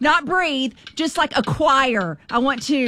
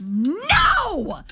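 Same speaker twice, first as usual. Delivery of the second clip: high, stretched-out protest; exasperated no; 5 semitones higher than usual. Real, not acted. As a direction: cry out a long, high NO. Exasperated at wrong guesses.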